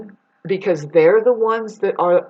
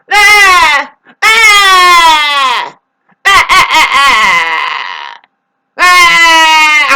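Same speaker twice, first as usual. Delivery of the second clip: high, loud wailing ah cries; ghost wail; 8 semitones higher, 11 dB louder, much slower than usual. Retold or imitated. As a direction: wail like a ghost that won't stop. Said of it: "Pretty soon it's like".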